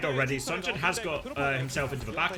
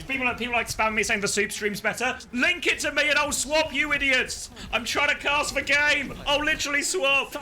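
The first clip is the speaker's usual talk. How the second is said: irritated, louder and higher; annoyed; 10 semitones higher, 5 dB louder than usual. Real, not acted. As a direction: snap at chat in annoyance. Annoyed at chat spamming.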